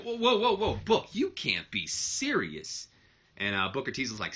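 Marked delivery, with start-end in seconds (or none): 0.0-2.9 high, laughing whoa whoa, slowing right down at the end; alarmed disbelief. Retold or imitated. Shane trying to stop Booker, shocked high whoa. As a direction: throw your hands up in shock. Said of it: "Shane's just kinda like".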